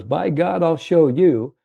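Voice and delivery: put-on a touch of the old man's stubborn pride; old salesman's voice. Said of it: "how he talked to".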